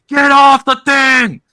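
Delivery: put-on angry dad yelling at kids on the bouncy castle; loud shout. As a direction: bellow it across the party. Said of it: "I did it loud".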